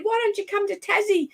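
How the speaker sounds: high-pitched, eager phone invite; high, thrilled suggestion; excited invitation. Retold or imitated. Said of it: "So that's so exciting".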